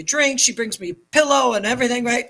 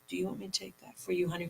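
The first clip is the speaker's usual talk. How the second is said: much softer, quick and kindly; lightly voiced caring flight attendant; gentle stewardess; 12 dB quieter, much faster than usual. Stewardess kindly offering to take the ashes, soft and quick.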